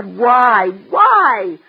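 loud, pressing why; nagging mother pushes hard; insistent demand. Acted. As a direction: demand an answer.

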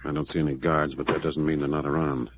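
very low, guarded undertone; hushed caution. Acted. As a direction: steer them quietly past possible guards. Sneaking into a guarded garage.

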